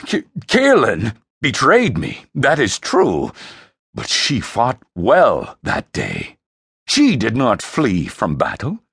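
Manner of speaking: commanding, deliberate ruler belittling his son; scornful emperor, emphatic. Acted. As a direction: pronounce judgement with proud contempt.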